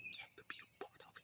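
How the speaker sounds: breathless whisper, dropped right down; hushed repeat under the breath. Shown as a demonstration. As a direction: whisper it as if to yourself. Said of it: "I have to be a part of it".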